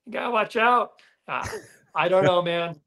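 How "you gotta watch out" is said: put-on a higher, hammy Australian accent; Aussie accent. Ending his Aussie accent bit for the host, a higher hammy Aussie accent. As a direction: warn of roos and dingoes like a cartoon Aussie. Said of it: "You, you're not too bad".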